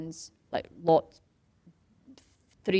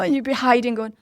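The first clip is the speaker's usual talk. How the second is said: usual speaking voice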